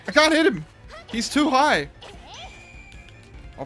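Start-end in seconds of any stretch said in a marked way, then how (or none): 0.1-1.9 high, loud frustrated complaint. Real, not acted. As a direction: complain loudly. Frustrated boss fight.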